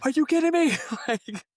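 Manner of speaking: high, loud disbelieving shriek with laughs; mock scream. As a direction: shriek it like you can't believe it. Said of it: "I wanna scream into the mic".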